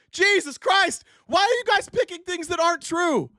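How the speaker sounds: very high, strained yell of frustration; exasperated outburst. Real, not acted. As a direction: explode at the audience's bad answers. Outburst at listeners' wrong answers.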